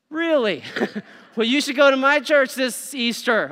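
high, loud playful really; mock surprise. Shown as a demonstration. Preacher acting out an Easter invite. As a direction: tease out the surprise. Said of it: "invitation line acted again".

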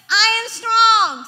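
loud, high, slow proclamation; bold declaration. Shown as a demonstration. Preacher showing what to say when weak, loud and bold. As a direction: belt it out with full conviction. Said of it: "You say".

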